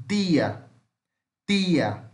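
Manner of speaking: Northeast Brazilian "tia" with a plain hard t; Northeast Brazilian accent. Shown as a demonstration. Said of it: "vai falar assim".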